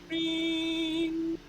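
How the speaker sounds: high bell-like ring; ringing chime. Acted. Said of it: "this ringing voice".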